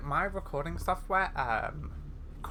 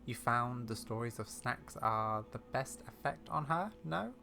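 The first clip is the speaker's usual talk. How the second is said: put-on wry, measured old lady with secrets; old lady character; 4 dB quieter than usual. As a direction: confide like a knowing elderly explorer, softly.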